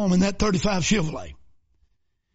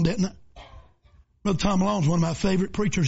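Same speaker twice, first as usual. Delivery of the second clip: normal talking voice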